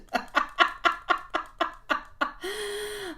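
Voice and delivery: high delighted laugh; real delighted laughter. Real, not acted. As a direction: let out a happy laugh. Delighted by song for mom.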